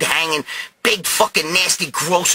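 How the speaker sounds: ordinary delivery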